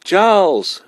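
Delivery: high, loud yell from afar. A narrator's reading. Boy yelling to his mother all the way uphill. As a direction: yell it out full voice. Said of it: "Laurie yelled all the way up the hill".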